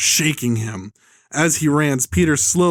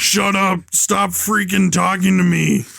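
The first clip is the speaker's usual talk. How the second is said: slow, lightly voiced angry villain; mock angry outburst; 4 semitones higher, slower than usual. Villain orders hostage to stop talking, mock angry. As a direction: act out a mildly furious order.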